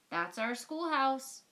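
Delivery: a townsperson showing off; lightly voiced proud townsfolk. A narrator's reading. Townsfolk voiced admiring schoolhouse.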